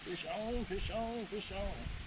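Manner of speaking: hushed fishing call; fishing whisper. Real, not acted. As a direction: whisper it while reeling in. Quiet calls while reeling.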